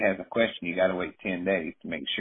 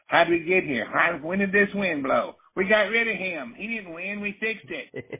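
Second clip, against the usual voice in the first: squeaky high-pitched mimicry, much higher; mock a rattled politician, way up high; 13 semitones higher than usual.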